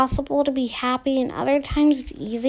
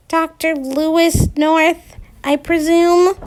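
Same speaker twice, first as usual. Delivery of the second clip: coyly; 6 semitones higher, 4 dB louder, slower than usual. Acted. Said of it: "Rachel says coyly".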